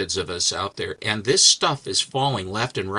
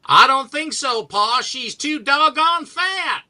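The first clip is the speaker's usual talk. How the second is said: high, drawn-out hillbilly holler; hammed-up cartoon bumpkin, pitched way up; hayseed hillbilly kid; 3 dB louder, slower than usual. Comic-book kid answering his Pa, high hayseed hillbilly voice. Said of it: "this hayseed hillbilly jackass".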